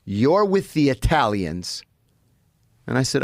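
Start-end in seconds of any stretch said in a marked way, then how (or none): 0.0-1.8 slow, deliberate guard's ruling, higher; stern prison guard. Retold or imitated. Guard assigning the new inmate to the Italians, slow and firm. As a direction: lay down the law slowly, syllable by syllable. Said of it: "He goes".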